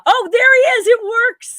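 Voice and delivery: high, loud burst of relief; excited cry. Real, not acted. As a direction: cheer as the host finally appears. Host reappears on screen.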